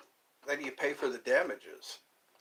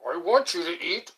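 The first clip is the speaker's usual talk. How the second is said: hammed-up movie-character impression; Zach Galifianakis; 3 semitones higher, 4 dB louder than usual. Quoting a movie line to viewers, hammed-up impression. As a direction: put on a comic character voice for the quote. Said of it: "Zach Galifianakis would say".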